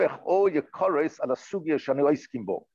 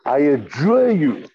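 mock archaic; 8 dB louder, much slower than usual. Shown as a demonstration. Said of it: "How's that for a word?".

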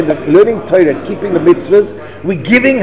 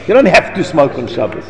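ordinary delivery

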